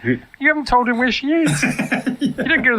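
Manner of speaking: high, exasperated; incredulous. Retold or imitated. S0 objects to the film scene, high and incredulous. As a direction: protest in disbelief. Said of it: "I'm like".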